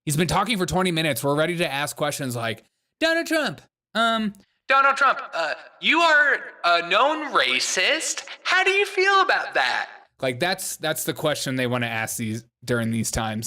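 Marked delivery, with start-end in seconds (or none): 3.0-9.9 very high, hammed-up indignant reporter; mock mad reporter. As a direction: caricature an accusing reporter, pitch shooting up on the question. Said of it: "She's very mad".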